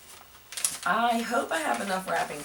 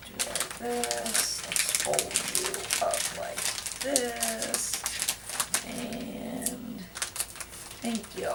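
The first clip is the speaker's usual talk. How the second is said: cooing to box; 5 semitones higher, 4 dB quieter, much slower than usual. Real, not acted. Talks to box, slow and cooing.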